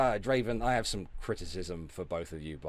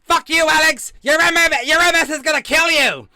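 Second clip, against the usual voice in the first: high, loud shrieking insult; shrieking mock rant at Alex; mock screaming quote; 18 dB louder than usual. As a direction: screech it like a raging viewer. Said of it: "I burst out laughing when she was like".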